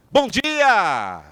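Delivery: high, loud and bright "Bom dia!" with a falling sweep; lively greeting. Shown as a demonstration. Trainer shows the class a voice full of intonation, beaming. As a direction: throw real energy into "Bom dia!". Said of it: "vamos colocar mais trinta e oito por cento".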